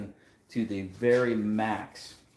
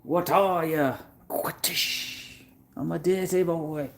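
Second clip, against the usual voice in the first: put-on movie quote in a Geordie accent; 8 semitones higher, slower than usual. Quoting an old movie to the host in a put-on Geordie accent. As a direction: ham up a thick Geordie accent from a film. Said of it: "Good effort with the accent".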